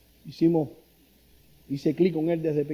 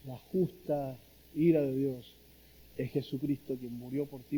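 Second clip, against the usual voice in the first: gentle earnest plea; 4 semitones lower, 5 dB quieter than usual. Retold or imitated. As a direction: quietly urge a dying woman toward faith. Preaching to dying aunt.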